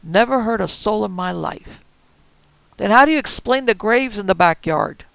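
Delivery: loud, biting yell back; yelling back at the armed couple; sarcastic yell. Acted. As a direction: shout the sarcasm through the door. Shouted argument through door.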